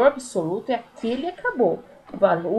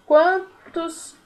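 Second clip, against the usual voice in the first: ordinary delivery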